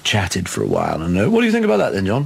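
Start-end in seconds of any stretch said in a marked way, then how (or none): 1.3-2.2 mock studio chat.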